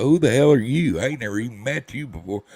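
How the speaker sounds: loud, high retold indignation; cantankerous. Old patient challenges a doctor he never met, cantankerous. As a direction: tell off a stranger, starting high and loud. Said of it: "I'm an old cantankerous part".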